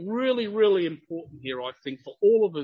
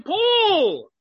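high, raised voice of an incredulous accuser; acted-out outburst at a man gone mad; Festus calling out loudly; 9 semitones higher than usual. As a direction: call out the name loudly, then keep the rebuke pitched high. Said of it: "Festus said with a loud voice".

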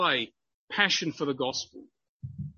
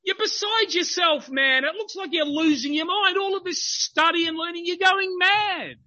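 high, raised voice of an incredulous accuser; acted-out outburst at a man gone mad; Festus calling out loudly; 9 semitones higher than usual. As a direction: call out the name loudly, then keep the rebuke pitched high. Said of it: "Festus said with a loud voice".

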